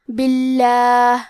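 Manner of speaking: باللہ slowly with a thin, light ل; thin lam. Shown as a demonstration. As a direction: recite باللہ slow, lam light not heavy. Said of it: "لام کو پتلی آواز میں پڑھیں گے". (باللہ میں لام پتلی آواز میں)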